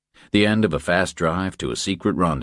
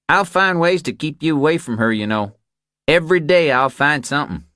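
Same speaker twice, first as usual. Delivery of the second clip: raised, higher pitched threat to keep him away; Bobby warning him off, pitched up and pushing; higher voiced Bobby; 10 semitones higher, 3 dB louder than usual. Bobby tells Reacher he'll keep him away, higher and forceful.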